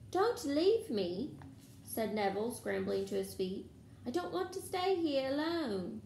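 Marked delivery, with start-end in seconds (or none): 0.1-1.4 high, slow, louder plea; pleading. A narrator's reading. Neville begs not to be left. 4.1-6.0 high-pitched anxious plea; pleading. A narrator's reading. Neville begs not to be left.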